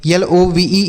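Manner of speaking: ordinary talk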